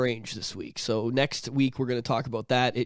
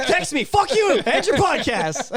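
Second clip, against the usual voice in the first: goofy prank voice; 13 semitones higher, 3 dB louder than usual. Acted. Mock caller prank voice.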